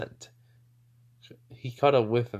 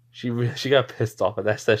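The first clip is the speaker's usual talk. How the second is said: ordinary delivery, nothing marked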